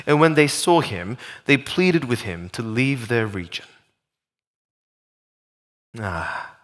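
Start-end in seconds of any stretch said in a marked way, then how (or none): 6.0-6.5 low, soft sigh of letdown; disappointed sigh. Acted. Preacher reacting to the passage's ending, disappointed sigh. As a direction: let out a deflated "ah".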